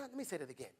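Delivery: usual speaking voice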